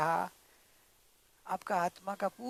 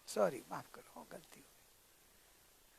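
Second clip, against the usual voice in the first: very soft, low, murmured apology; calm apology; 5 semitones lower, 16 dB quieter, slower than usual. Acted. Daughter-in-law admits her mistake to father-in-law, calm. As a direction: back down humbly, almost under the breath. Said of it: "आपको शांत जल डालने का".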